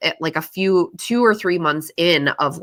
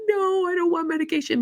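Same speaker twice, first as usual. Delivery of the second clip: put-on much higher, childish refusal; high petulant inner voice; whiny inner protest; 14 semitones higher, 4 dB quieter than usual. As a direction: whine like a sulky kid.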